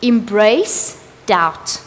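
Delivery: embrace doubt, drawn out very slowly; preacher stretching embrace doubt into a slow plea; slow emphatic plea. Real, not acted. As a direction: lean on each word of embrace doubt, slow and deliberate. Preacher urging the congregation.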